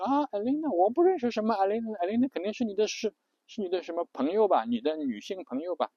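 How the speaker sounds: high, fast flustered dodge; nervous denial. Retold or imitated. The husband caught out by his wife, in a high flustered rush. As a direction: play dumb quickly, a bit panicked. Husband denies knowing Elena.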